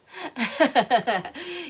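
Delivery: loud amused laugh; burst of laughter. Real, not acted. Reader laughing after mocking her viewers, loud. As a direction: laugh at your own joke.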